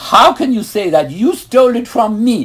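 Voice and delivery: put-on high and loud, indignant child; retold indignant shout; outraged protest. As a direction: protest your innocence loudly.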